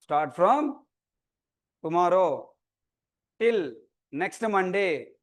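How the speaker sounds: broken up with long pauses; piece-by-piece dictation. Real, not acted. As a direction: repeat it in small chunks, pausing after each. Repeats dates piece by piece.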